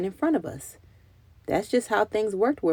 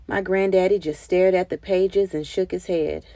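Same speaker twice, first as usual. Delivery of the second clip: ordinary delivery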